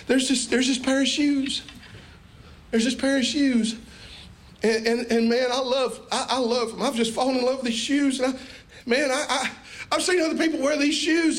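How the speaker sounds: put-on high, eager pleading child voice; excited kid pleading with mama; begging child. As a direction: gush about the shoes like a kid selling it to mama. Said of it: "let's pretend like we're going to mama".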